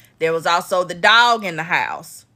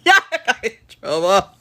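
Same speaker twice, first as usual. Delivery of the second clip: laughing; faster than usual. Real, not acted. Laughing, pleading with the chat.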